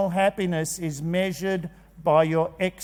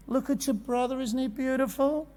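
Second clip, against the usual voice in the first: higher, softer cooing praise; doting parent; 4 semitones higher, 7 dB quieter than usual. Retold or imitated. Parent comparing children without thinking, soft and doting. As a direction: gush softly over the favoured child, pitched up.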